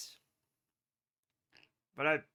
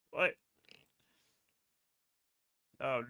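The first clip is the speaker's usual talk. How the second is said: usual speaking voice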